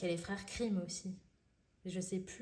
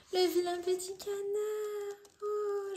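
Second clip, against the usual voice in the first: much higher and louder, slow; excited squeal; 12 semitones higher, 5 dB louder, slower than usual. Real, not acted. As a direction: squeal with joy at finding it. Excited to find a favourite tale.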